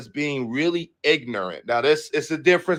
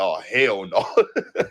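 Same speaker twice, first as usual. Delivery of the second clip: slow, drawn-out laughing refusal; mock refusal with a laugh; laughing hell no; 6 semitones lower, much slower than usual. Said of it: "I'm like".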